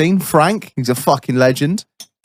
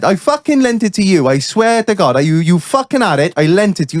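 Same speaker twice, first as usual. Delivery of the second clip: put-on insistent, raised-pitch Welsh accent; 6 semitones higher than usual. Welsh workman swearing he lent the tool, Welsh accent. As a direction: protest like an aggrieved Welshman. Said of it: "I'll do my best Welsh accent".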